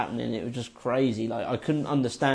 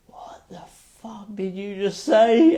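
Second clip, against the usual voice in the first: slow whisper climbing into high-pitched disbelief; incredulous question; 8 semitones higher, slower than usual. Retold or imitated. Tripping man to the guy who spoke, incredulous rising whisper. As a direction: start breath-only, stretch it out and rise. Acting out reply to strange voice.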